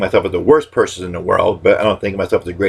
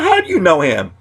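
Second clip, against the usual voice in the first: 1 high mock disbelief; 10 semitones higher than usual. Catchphrase follow-up in same voice.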